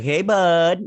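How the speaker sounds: loud, high, drawn-out hail; imitated big chummy hello; hearty mock greeting. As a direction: call it out like an over-friendly pal.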